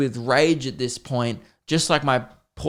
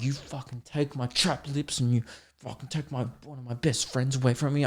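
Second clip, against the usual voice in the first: seething under the breath, much softer; hushed mock tirade; muted raging; 9 dB quieter than usual. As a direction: rage quietly at an enemy who robbed you. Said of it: "And I'm just raging".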